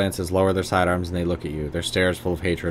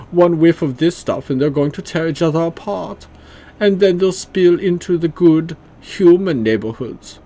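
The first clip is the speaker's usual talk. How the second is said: hammed-up bad German accent, higher and louder; awful German accent; 8 semitones higher, 6 dB louder than usual. Leader Stahl interrogates the troll in an awful German accent. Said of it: "I'm just giving him a awful German accent".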